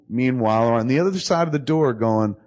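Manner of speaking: ordinary delivery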